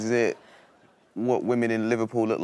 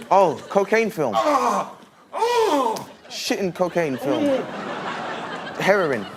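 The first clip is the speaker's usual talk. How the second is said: excited guessing; 12 semitones higher than usual. Real, not acted. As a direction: shout every guess, eager. Frantic guessing in charades.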